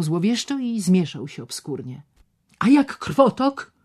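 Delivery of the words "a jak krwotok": alarmed doctor. Acted.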